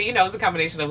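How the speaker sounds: ordinary talk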